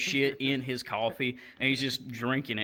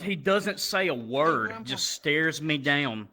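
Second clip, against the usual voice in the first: ordinary talk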